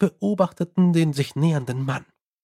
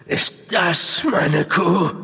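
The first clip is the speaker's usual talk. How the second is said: slow, hollow questioning voice; eerie ghostly voice; much slower than usual. Acted. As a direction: ask it like a lost spirit. Apparition's first asking.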